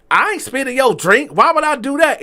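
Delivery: high, loud indignant denial; loud high retort. Retold or imitated. Waiter snapping back loud and high at the accusing diner. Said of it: "And he said it loud".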